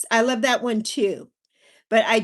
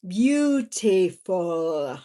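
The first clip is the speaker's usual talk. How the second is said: drawn-out sigh; 6 semitones lower, much slower than usual. Real, not acted. Drawn out savouring.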